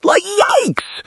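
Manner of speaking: a high, stretched yikes; shocked yikes. Acted. Vlogger reacting to the texts, high shocked yikes. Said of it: "Emotional reaction".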